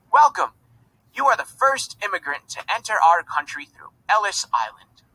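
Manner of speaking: high, formal welcoming gentleman; formal official. A narrator's reading. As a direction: welcome her grandly.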